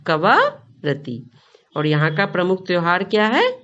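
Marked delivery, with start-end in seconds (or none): 0.1-1.3 Kavaratti drawn out very slowly, syllable by syllable; slow repeat-after-me. Shown as a demonstration. Teacher leads the class to repeat Kavaratti, very slowly. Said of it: "बोलिए मेरे साथ".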